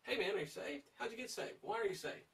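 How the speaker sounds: pressing questioner. Acted. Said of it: "pressure them and ask them".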